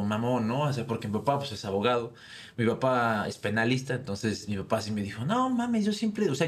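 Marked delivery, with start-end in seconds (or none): 5.2-6.5 high, scoffing outburst from the father; mock the thugs' threat as the dad. Father shrugging off the gun threat, high scoffing voice.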